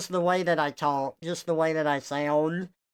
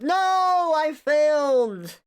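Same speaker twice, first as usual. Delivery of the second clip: much higher and louder, stretched-out wail, slowing down; hammed-up cry of defeat; mock despair; 10 semitones higher, 6 dB louder, much slower than usual. Streamer to chat, hammed-up louder despair at failing.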